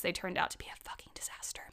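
fast, hushed admission; under-the-breath whisper, letting them in on it; whispered confession. Real, not acted. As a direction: drop into a quick whisper to confess it. Whispered confession about failed cookies.

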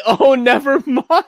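high, laughing quoted line. Retold or imitated. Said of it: "She said".

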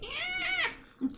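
squeaky, very high cheer; tiny pet voice. Pretend. Friend voicing her pet's claw caught in jeans, squeaky cheer. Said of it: "Her little rat claw was like".

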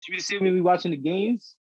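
ordinary talk, nothing marked

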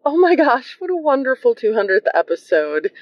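ordinary delivery

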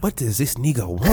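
attempted Russian accent sliding into African. Puzzled Russian designer at his translator, mock Russian-African accent. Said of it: "Russian to African accent".

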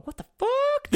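squeaked very high in a put-on voice; shocked squeal. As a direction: squeal it in mock disbelief. Team stunned he plays every match.

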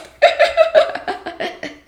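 high breathy laugh bursting out; burst of laughter. Real, not acted. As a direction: let a big laugh escape. Nervous laugh about releasing episode.

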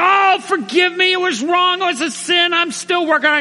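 very high mock-pleading voice; frantic pleading. New believer apologizes for the second punch, frantic. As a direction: beg forgiveness in a squeal of panic.

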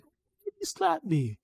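very high, slow and loud; hurt cartoon voice. Cartoon character asking the rabbit about a slap, high hurt voice. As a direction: wail it in a high falsetto.

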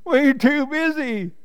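high-pitched bleating sheep voice; baaing sheep. A narrator's reading. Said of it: "baaed the sheep".

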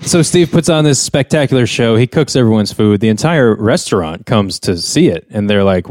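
ordinary delivery

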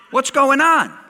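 high, loud acted question; mock indignant outburst; exasperated demand. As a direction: demand an answer as the upset wife. Acts the upset wife's demand.

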